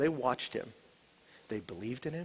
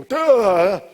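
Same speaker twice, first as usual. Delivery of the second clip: mock duh; 5 semitones higher, 14 dB louder than usual. Mocking obvious answer.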